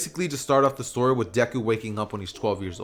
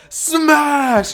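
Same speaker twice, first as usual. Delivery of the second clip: high, booming battle cry; heartfelt yell; 14 semitones higher, 8 dB louder than usual. Acted. Deadpool trying out his new hero move, heartfelt yell. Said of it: "from the bottom of your heart yell".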